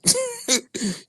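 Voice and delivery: a squealing falsetto laugh at him; high scornful laugh; high mocking laugh. Real, not acted. Laughing at a cartoon character.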